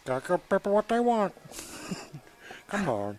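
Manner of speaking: giggly sneering imp. Acted. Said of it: "Stop doing the Bobby voice right now".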